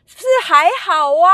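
high, loud put-on mom brushing it off; stubborn mom. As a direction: squeal out an "it's fine". 妈妈嘴硬说还好. (尖声模仿妈妈说还好)

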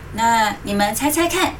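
plain reading voice

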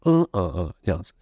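normal talking voice

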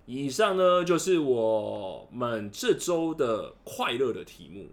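loud, slow show announcement; announcer voice. Acted. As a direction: announce the segment like a host. Podcast segment wrap-up announcement. (主持人播报语气)

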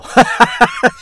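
loud high burst of laughter; big laugh. Retold or imitated. Laughing at a friend's confession, a loud high laugh. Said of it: "哈哈大笑".

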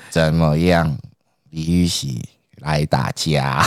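innocently provoking like a dim recruit; slow dopey taunt. Retold or imitated. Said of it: "就是讲话很慢".

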